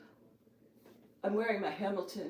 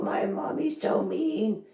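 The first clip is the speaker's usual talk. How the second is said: Exorcist voice; 4 dB louder, slower than usual. Acted. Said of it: "the Exorcist voice, Linda Blair".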